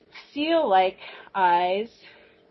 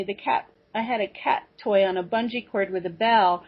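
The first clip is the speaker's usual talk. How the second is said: ordinary delivery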